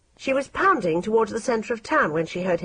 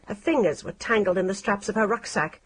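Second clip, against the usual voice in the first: plain reading voice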